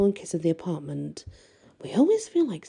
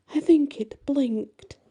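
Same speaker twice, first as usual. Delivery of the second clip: spooked; 9 semitones higher, slower than usual. A narrator's reading. Creepy doll seems to blink.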